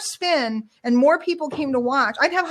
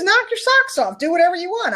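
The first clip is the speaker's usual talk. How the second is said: carefree brush-off; 8 semitones higher than usual. Retold or imitated. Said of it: "He goes".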